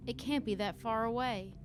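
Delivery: usual speaking voice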